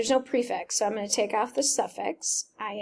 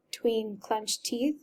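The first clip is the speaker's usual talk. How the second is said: usual speaking voice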